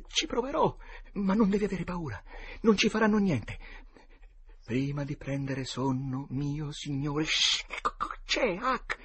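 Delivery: put-on high, tense boy's voice, frightened; high frightened kid voice, on the edge of tears; scared boys. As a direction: play a terrified boy hiding among graves. Boy reassures friend.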